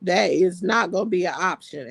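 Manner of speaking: ordinary delivery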